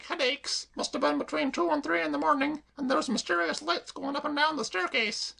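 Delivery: high, chirpy cockney maid voice; perky maid reassuring her mistress; Claire the maid. Acted. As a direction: pitch her up and pert. Claire's long speech.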